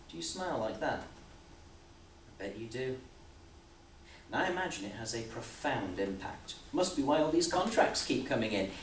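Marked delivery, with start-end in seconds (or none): none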